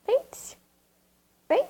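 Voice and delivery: high sing-song call to the dog. Real, not acted. Calling the pet like her own dog, high baby talk. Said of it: "eu sou acostumada a falar assim com ele".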